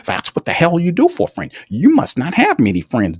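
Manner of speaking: a hint of a self-satisfied boss defending a favour; Mickey Loomis. Mocking the GM over a trade, lightly voiced smug executive. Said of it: "what kind of bullshit Mickey Loomis is running".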